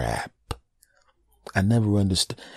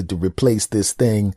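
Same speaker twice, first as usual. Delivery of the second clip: usual speaking voice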